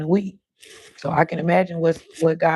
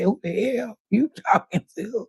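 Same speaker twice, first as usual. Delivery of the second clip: mother scolding; 6 semitones higher than usual. Retold or imitated. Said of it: "Mama said".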